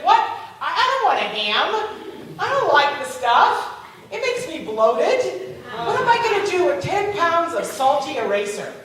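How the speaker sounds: mock indignant protest. Woman who won a ham she doesn't want, mock indignant. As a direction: balk at it, drawing out the objections. Winner balks at unwanted ham.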